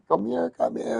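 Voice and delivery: high coaxing call; mimicking a roommate coaxing her dog; Lita calling the dog. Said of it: "and Lita was like".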